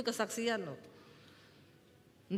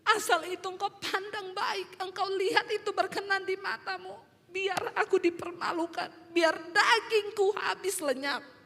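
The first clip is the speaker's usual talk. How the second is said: tearful surrender; 8 semitones higher than usual. Retold or imitated. Weeping pastor surrendering to God, high tearful voice. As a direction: re-enact yielding to God through streaming tears. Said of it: "Saya bercucuran air mata ngomong".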